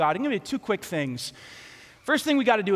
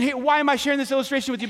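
ordinary delivery

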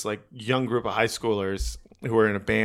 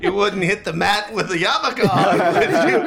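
laughing, louder, rising to a high squeak; imagined taunt; 6 semitones higher, 4 dB louder than usual. Retold or imitated. Comedian imagining taunting the big guy with a yarmulke, laughing mock taunt. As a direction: tease him laughing through it. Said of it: "be like".

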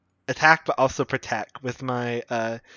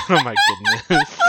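squealing high through laughter; laughing. Real, not acted. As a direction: burst out laughing mid-word. Player reacts to Deep Speech joke.

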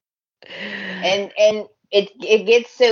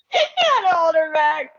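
high laughing voice; 11 semitones higher than usual. Real, not acted. Fan retelling Brooke being held back, laughing. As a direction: laugh through the words.

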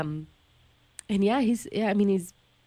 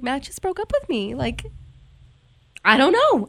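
high, louder, breaking down; distraught bawling; 4 semitones higher, 5 dB louder than usual. Retold or imitated. Telling her two friends, distraught and louder. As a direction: repeat it as you fall apart. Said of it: "I'm just bawling".

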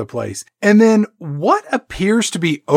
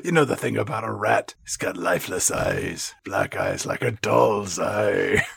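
ominous monologue voice; 6 semitones lower, 10 dB quieter than usual. Pretend. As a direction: speak like a haunted old sailor spinning a dread tale. Ominous movie-monologue parody about rats.

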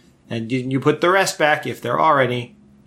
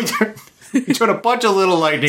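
upbeat sales pitch, laughing through; infomercial voice; 6 semitones higher than usual. Shown as a demonstration. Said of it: "this sounds like an infomercial sales pitch".

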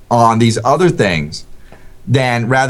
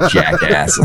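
laughing insult; 9 semitones lower than usual. Real, not acted. Laughing after being teased.

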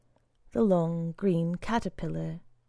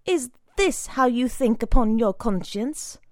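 louder, higher angry rebuke; furious scolding; 5 semitones higher, 6 dB louder than usual. A narrator's reading. Brother Timothy scolding Cassidy, furious louder rebuke. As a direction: tear into the boy.

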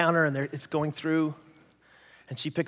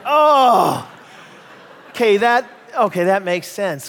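louder, laughing with relief; relieved laugh; 2 semitones higher, 8 dB louder, slower than usual. Retold or imitated. Pastor realising she meant scan guns, laughing relief. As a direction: laugh it off, embarrassed. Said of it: "I went".

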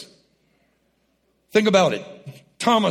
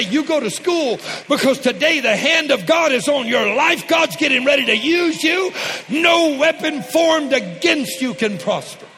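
shouted blessing; 6 semitones higher than usual. Acted. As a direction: proclaim it over your kids. Voicing parent blessing kids.

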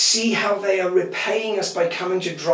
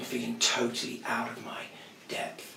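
fading to a whisper, much softer; 5 semitones lower, 11 dB quieter than usual. A narrator's reading. Pastor recalling weeping alone, whispering. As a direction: let the words sink into a whisper.

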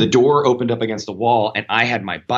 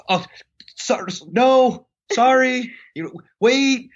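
very high, stretched-out pleading cries; acted panic; slower than usual. Man on the toilet stopping the nun, acted panic. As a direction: squeal as if desperately stopping someone, hammed-up. Re-enacts panicked calls to stop her.

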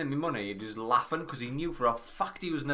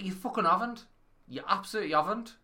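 angry denial; 8 semitones higher than usual. Retold or imitated. Retold phone row.

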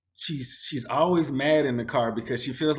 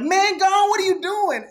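much higher, loud, frantic plea from the back seat; panicked friends; 4 dB louder than usual. Retold or imitated. Friends ducking in the back seat, frantic. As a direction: hiss at him in a panic to move. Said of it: "ducking down like".

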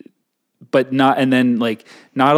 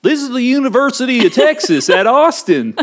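high, raised mock announcement; mock announcer; 10 semitones higher, 4 dB louder than usual. Mocking how the university names itself, high mock announcement. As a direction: proclaim it in a hammed-up higher voice.

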